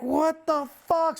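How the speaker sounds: slow, high exclamation of alarm; retold shock. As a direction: act out a stunned reaction, stretched and rising. Retelling his reaction to the crash.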